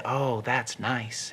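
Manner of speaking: slow, soft, blissed-out Lenny; dreamy contentment. Acted. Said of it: "Lenny said".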